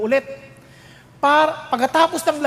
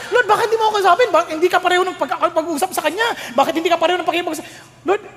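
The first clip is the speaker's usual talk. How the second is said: whiny complaint; 6 semitones higher, faster than usual. Retold or imitated. Believer complaining to God.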